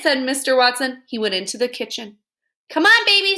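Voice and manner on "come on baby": high, loud urging; coaxing. Acted. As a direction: coax her to come along. Mr Watson coaxing.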